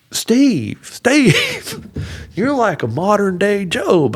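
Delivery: high-pitched imitation of amazed sympathizers; astonished churchgoers. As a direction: exclaim over someone's misfortune, acted out. Church people exclaim, retold.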